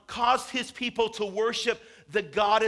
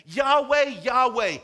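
Yahweh, Yahweh called out loud and high; shouted proclamation; 5 semitones higher, 8 dB louder, slower than usual. Acted. God proclaiming his name to Moses, in a loud high call.

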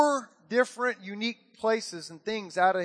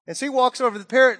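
normal talking voice

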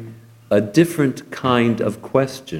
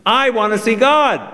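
bossy little princess; 12 semitones higher than usual. A narrator's reading. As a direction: whine it like a spoiled royal. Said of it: "retorted the princess".

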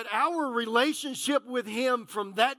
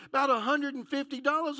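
usual speaking voice

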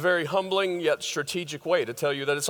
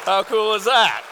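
loud proud exclamation; excited; 5 semitones higher, 7 dB louder than usual. Real, not acted. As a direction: belt it out with joy. Proud grandfather reveal.

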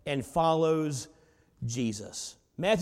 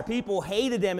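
ordinary talk

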